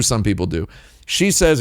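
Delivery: ordinary delivery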